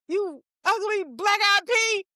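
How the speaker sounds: slow, high-pitched taunt; mock troll insult. Comedian showing how to provoke a celebrity's reply, taunting. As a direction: stretch out each insulting word, pitched high.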